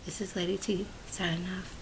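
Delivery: breathy whisper to close the video; whispered sign-off. Real, not acted. Lady T signing off to her viewers, whispered. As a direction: whisper it as a goodbye.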